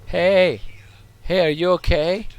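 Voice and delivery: loud, high "are you okay?" call. Pretend. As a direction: call over to someone who may be hurt. Calling out to game character.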